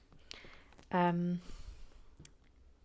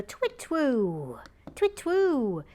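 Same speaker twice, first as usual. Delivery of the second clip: long, loud, swooping hoot; owl hoot; 6 semitones higher, 6 dB louder, much slower than usual. Acted. Greeting a viewer named owl, loud imitation owl hoot. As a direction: hoot it like an owl, falling at the end.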